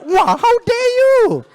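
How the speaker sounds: hammed-up outraged yell, very high and drawn out; angry. Scammer scolding the man who exposed him, angry. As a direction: stretch it into a loud, indignant shriek. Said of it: "be angry, you know".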